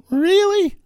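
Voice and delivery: loud squeaky puberty crack. Shown as a demonstration. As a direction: crack like a teenage boy. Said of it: "Like I'm a fourteen-year-old boy going through puberty. My voice cracked".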